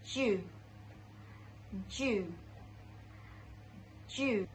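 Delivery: "ju" with a soft j, repeated with pauses; correct ju sound. Shown as a demonstration. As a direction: say "ju" as "joo", each one separate. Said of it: "but it should be".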